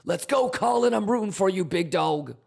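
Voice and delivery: high, loud cheering on; root for him like a fan; hype cheering. Real, not acted. Cheering a viewer on.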